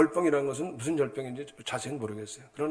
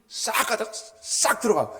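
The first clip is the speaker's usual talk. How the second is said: lively retelling; 8 semitones higher, 7 dB louder, slower than usual. Retold or imitated. Acting out car swerving in.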